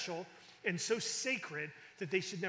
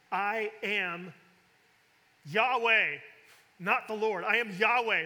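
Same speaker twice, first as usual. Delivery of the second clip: booming declaration; 3 semitones higher, 7 dB louder than usual. Acted. Preacher reading God's words to Moses, loud and proclaiming. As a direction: proclaim the name like God himself.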